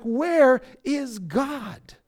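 very slow, stretched-out question; drawn-out questioning. Acted. Believer worn by long trials, slow drawn-out questioning. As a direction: drag out each word in doubt.

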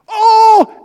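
high, loud exclamation of discovery; the light bulb goes on, cry out; sudden realisation. Acted. Preacher voicing Paul realising, loud surprised oh.

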